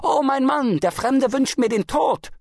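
very high, loud wail for help; screaming. A narrator's reading. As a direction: shriek the accusation to summon rescue. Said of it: "fing an zu schreien".

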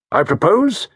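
clear and decisive. Acted. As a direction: announce the plan with firm resolve. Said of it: "His voice was clear and decisive".

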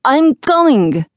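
high, loud, drawn-out reply; calling out. Acted. Jack answers the yelling officer, calling out loudly. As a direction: holler back towards the door, stretching the words.

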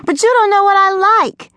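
put-on very high girlish yell; yelling after him. A narrator's reading. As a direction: call out shrilly after a boy running off. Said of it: "Imani yelled after him".

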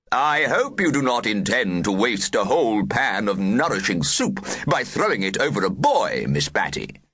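a hint of a pompous head teacher; lightly voiced stern head teacher. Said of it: "spoke in his head teachering voice".

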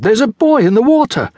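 put-on a girl spotting a boy in the sea, alarmed cry. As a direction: shout out in sudden alarm. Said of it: "she cried".

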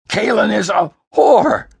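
high, loud furious outburst; raging, high-pitched yell; hoarse scream. Acted. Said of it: "Larissie screamed hoarsely".

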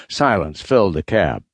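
usual speaking voice

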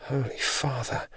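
hushed, slow gasp of dismay; soft, drawn-out alarm under the breath; said to herself. A narrator's reading. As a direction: breathe it softly to yourself, appalled. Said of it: "Yvette said to herself, panicking".

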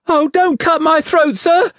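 put-on very high little child's voice begging; terrified pleading. A narrator's reading. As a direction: plead for your life in a high squeal. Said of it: "I pleaded in terror".

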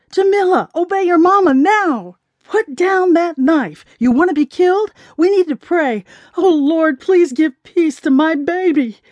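high, urgent motherly command turning to prayer. A narrator's reading. Mama ordering daughter to drop knife, urgent pleading. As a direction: plead high and insistent, then implore.

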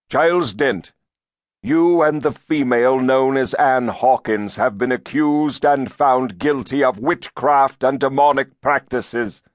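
high, loud proclamation of guilt; stern accusing elder. A narrator's reading. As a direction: pronounce the charge like a zealous judge. Elder pronounces witchcraft accusation.

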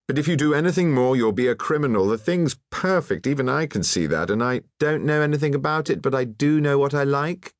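high, forceful, savage mimicking quote; savage quoting. A narrator's reading. Painter quoting clichés at the onlooker, savagely. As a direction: throw the cliché back savagely, pitched well up. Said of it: "quoted the lady savagely".

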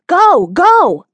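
high, loud urgent yell. A narrator's reading. Passenger yelling at the driver to drive. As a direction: push the truck on with your voice. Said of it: "points straight ahead and yells".

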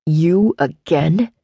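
slow, low growling man; deep growl. A narrator's reading. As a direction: growl it out slowly, unimpressed. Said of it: "she could hear his deep growl".

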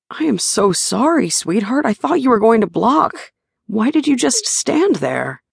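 sorry and puzzled; lightly voiced fretting partner; apologetic Shane. A narrator's reading.

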